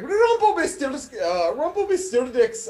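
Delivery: bad, high, loud Scottish accent; hammed-up Scottish brogue; Scottish accent attempt. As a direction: try a Scottish accent and fail. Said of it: "gotta work on my Scottish accent".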